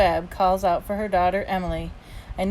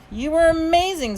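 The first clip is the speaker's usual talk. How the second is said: high, delighted praise; proud and thrilled; gushing praise; 9 semitones higher, 4 dB louder than usual. A narrator's reading. Dad hugging his daughter backstage, gushing.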